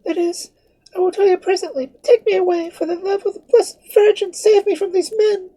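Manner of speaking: very high, breathless frightened plea; panting, begging him not to ask; terrified girl gasping to the priest; gasping for breath. Acted. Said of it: "gasping for breath".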